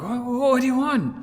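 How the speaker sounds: flustered at the stranger at the door; startled demand. Retold or imitated. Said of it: "and so I was like".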